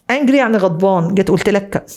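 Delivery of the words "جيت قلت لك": normal talking voice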